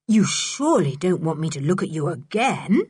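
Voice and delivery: usual speaking voice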